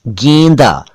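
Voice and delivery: "गेंदा" as GEYN-da; correct close vowel. Shown as a demonstration. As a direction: say "गेंदा" with a narrow ay. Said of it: "गेंदा नहीं".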